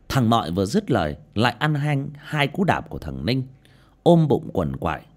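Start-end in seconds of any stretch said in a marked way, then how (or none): none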